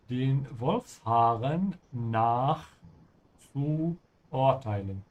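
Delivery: Den Wolfshaaren nach zu urteilen, spoken slowly word by word; teacher spelling it out slowly for a learner; slow, deliberate model phrase; slow model sentence. Shown as a demonstration. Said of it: "I'm gonna help you a little bit".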